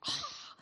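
hushed, breathy gasp of being moved; gut-deep gasp. Acted. Preacher imitating Jesus deeply moved, hushed gasp. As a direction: let out a whispered sigh from the gut. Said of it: "in the gut, it was like".

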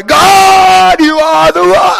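put-on high, loud child's worship cry; daughter worshipping. Daughter sings out to God in the corner, high and loud. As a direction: belt it out like a little girl praising God.

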